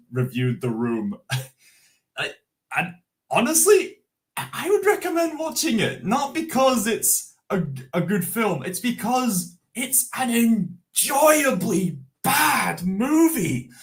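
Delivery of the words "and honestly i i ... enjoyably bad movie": higher, eager enthusing; excited. Real, not acted. Recommending The Room to chat, excited. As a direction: gush about it. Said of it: "excited recommendation".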